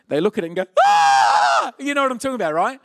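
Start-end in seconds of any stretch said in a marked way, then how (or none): 0.8-1.7 sudden high, loud shriek; comic full-volume wail; mock child's scream. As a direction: scream like a kid just noticing a cut. Child sees cut finger, screams.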